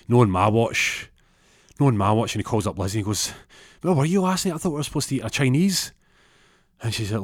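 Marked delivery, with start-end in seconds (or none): 0.1-2.3 loud, emphatic vow; acted-out determined refusal; defiant. Vows to stop the rival. 3.8-5.9 high, indignant confrontation; acted-out jealous boyfriend on the attack; pitched-up, hurried reproach; accusing. Confronts girlfriend by phone.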